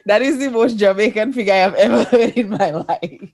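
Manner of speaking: bursting into laughter mid-sentence; laughing. Real, not acted. Friend laughing at a cheeky Jamaican joke. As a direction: laugh through the words in disbelief.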